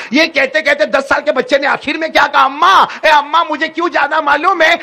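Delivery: put-on a crying boy begging his mother, rising to a shout; pleading, building. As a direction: plead harder with each line until it bursts out. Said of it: "روتے روتے کہا".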